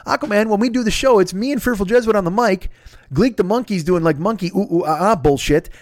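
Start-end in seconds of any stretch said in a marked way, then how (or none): none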